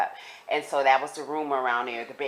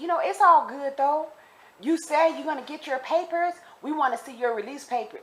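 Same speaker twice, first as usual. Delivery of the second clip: taunting inmates; 9 semitones higher than usual. Retold or imitated. Acted threat from group of inmates.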